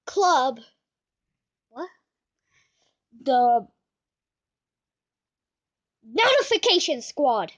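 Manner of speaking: ordinary delivery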